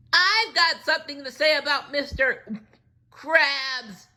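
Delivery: very high, loud sponge voice; an angry crazed sponge; SpongeBob announcing. Acted. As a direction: make the big announcement. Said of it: "SpongeBob announced".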